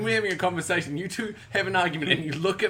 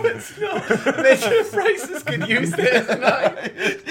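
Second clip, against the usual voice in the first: ordinary talk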